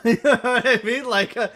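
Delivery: laughing loudly through the words. Real, not acted. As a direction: cohost giggling at the idea of signing everyone, laughing. Laughing at the roster idea.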